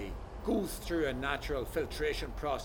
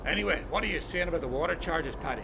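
ordinary talk

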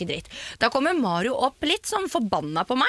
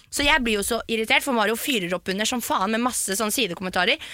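usual speaking voice

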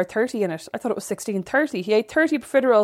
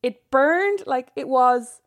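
laughing; 6 semitones higher, much slower than usual. Real, not acted. Laughing while recalling pain.

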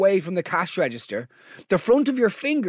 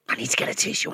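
whisper; 7 semitones lower, 5 dB quieter, much faster than usual. Retold or imitated. Whispered aside to the queue.

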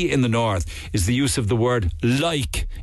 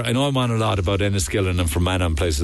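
ordinary talk

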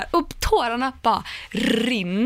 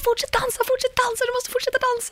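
much higher, urging mother's voice; high, pleading encouragement; cheering mom; 14 semitones higher than usual. Retold or imitated. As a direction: egg him on like a moved mother from the crowd. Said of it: "hon står där och bara så här".

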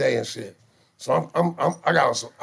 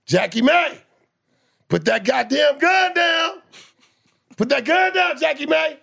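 high, loud slow police shout; police command; 12 semitones higher, 4 dB louder, slower than usual. Retold or imitated. Police ordering mama to drop the gun, high loud shout. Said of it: "This is what they said".

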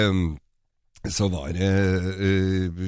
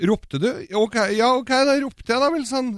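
higher, rising embarrassed admission; sheepish mock reply; 9 semitones higher than usual. Club man admits he called out, higher sheepish voice. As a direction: play the caught man owning up. Joke punchline, playing the man.

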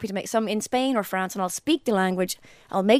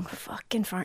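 muttered; 9 dB quieter, slower than usual. Retold or imitated. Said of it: "they're going".

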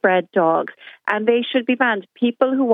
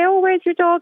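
high, sing-song feigned interest; mock sweet question; 12 semitones higher than usual. Caller voicing herself meeting the owner later, high and falsely sweet. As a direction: ask with fake sweetness.

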